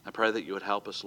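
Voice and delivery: ordinary talk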